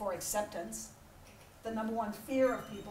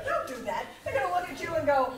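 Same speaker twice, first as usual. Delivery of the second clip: ordinary talk, nothing marked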